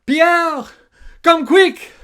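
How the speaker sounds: high, loud shout for help; urgent call. Acted. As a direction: yell for the mechanic in a panic. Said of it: "Captain Cockroach called".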